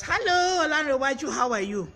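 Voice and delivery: high, loud hello easing down in pitch; excited greeting. Real, not acted. Host greets a viewer joining live, excited loud call-out. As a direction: call out loudly to a newly arrived friend.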